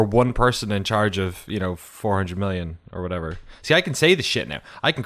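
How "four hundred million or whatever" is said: ordinary talk, nothing marked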